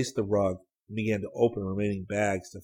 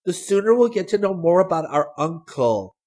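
put-on high, loud girlish eagerness; eager; 10 semitones higher, 8 dB louder than usual. A narrator's reading. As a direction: press on keenly in a raised voice. Sally eager to learn more.

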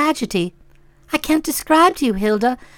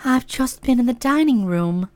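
tremor in the voice; 3 semitones lower, 6 dB quieter than usual. Acted. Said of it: "one spotted the tremor in the voice".

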